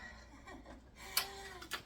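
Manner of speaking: high-pitched laugh. Real, not acted. Vlogger finding the screw doesn't match, laughing.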